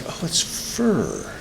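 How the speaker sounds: slow, soft, drawn-out discovery; hushed, lingering reaction; dawning realisation. Retold or imitated. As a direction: stretch out FUR as it sinks in. Acting out the moment of realisation.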